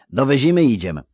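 eager country lad voice; rustic young recruit. Acted. Melfi, rustic recruit speaking.

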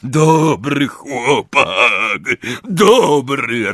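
loud, slow, drawn-out bellow; big booming praise; roaring. A narrator's reading. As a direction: roar it out, stretching the words. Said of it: "ryknął".

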